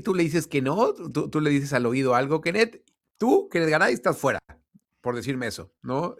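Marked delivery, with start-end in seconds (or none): 2.4-4.4 hammed-up president singling out a journalist; mock Florentino. Imitating Florentino pointing at him.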